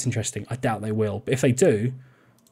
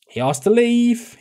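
much higher and slower, protesting; exclaimed protest; 10 semitones higher, 4 dB louder, slower than usual. Real, not acted. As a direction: insist it wasn't your fault, high and drawn out. Defending a player's exit.

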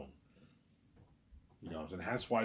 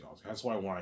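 ordinary talk, nothing marked

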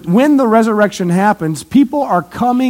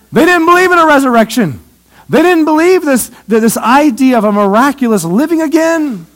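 emphatic preaching; 6 semitones higher, 4 dB louder than usual. Real, not acted. Preacher stressing the Sadducees' unbelief.

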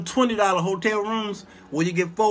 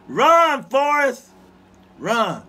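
movie quote shout; 6 semitones higher, 5 dB louder, much slower than usual. Retold or imitated. Forrest Gump movie quote.

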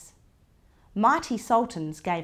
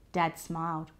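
ordinary delivery

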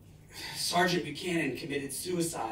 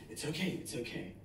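hushed, much softer soothing; calm him gently; soft reassurance; 3 semitones lower, 13 dB quieter than usual. Retold or imitated. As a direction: girlfriend soothing a startled partner. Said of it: "my girlfriend at the time goes".

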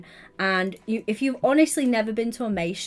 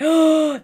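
loud, high delighted gasp; big sharp intake of surprise; surprised gasp; 7 semitones higher, 9 dB louder than usual. Real, not acted. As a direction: gasp at a friend turning up. Spots a viewer arriving.

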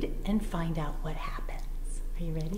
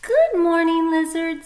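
much higher and louder, beaming bunny hello; cheery bunny greeting; 10 semitones higher, 11 dB louder than usual. Acted. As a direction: call out a bright good morning to a friend.

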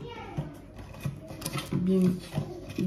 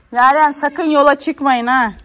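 calling out; 5 semitones higher, 9 dB louder than usual. Real, not acted. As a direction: call the warning across the yard. Mother calls kids outside.

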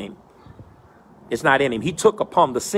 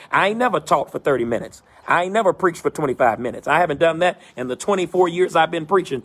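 ordinary talk, nothing marked

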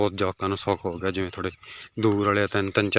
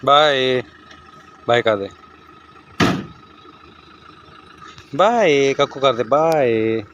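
calling out; 4 semitones higher, 7 dB louder, slower than usual. Real, not acted. Calling goodbye as van leaves.

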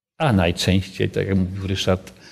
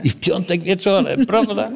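real laugh, voice high; 9 semitones higher than usual. Real, not acted. Leader laughs while admitting Friday evening trade. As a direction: laugh it out, rueful.

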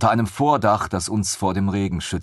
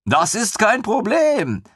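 high, slow, glowing with pride; a proud lieutenant, beaming; 14 semitones higher, 4 dB louder, slower than usual. Acted. Stockfisch beaming at the companions, high and slow. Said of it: "strahlte Stockfisch".

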